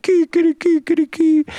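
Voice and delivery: high crowing cries; crying rooster. Acted. Rooster joining in the crying, high mock crowing. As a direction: crow it high like a wailing rooster. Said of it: "And the rooster said".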